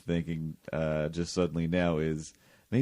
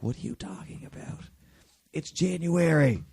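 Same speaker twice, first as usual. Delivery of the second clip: incredulous; 9 semitones higher, 4 dB louder than usual. Retold or imitated. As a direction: act baffled everyone else is cold. Acting out everyone else's disbelief.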